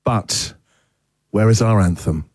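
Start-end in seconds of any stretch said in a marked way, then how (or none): none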